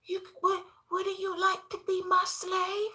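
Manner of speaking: mock little girl teasing. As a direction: play the spoiled little girl, high and sugary. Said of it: "So she says".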